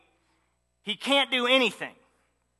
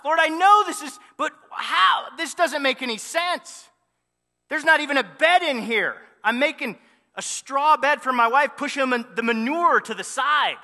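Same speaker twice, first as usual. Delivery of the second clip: exasperated plea; 4 semitones higher than usual. Acted. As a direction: argue with God, at a loss. Joseph protesting to God in stable.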